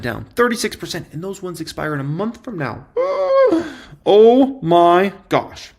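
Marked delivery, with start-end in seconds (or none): 2.9-3.7 sharp gasp, much higher; a theatrical gasp of shock; dramatic gasp. Acted. Host reacting to put losses with a mock gasp. 4.1-5.7 drawn out slowly, a touch louder; mock astonishment. Host marvelling at the shorts' losses, slow exaggerated dismay.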